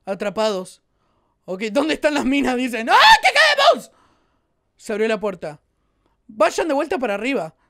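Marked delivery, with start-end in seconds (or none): 2.9-3.9 alarmed yell. Real, not acted. El ascensor cae en la mina.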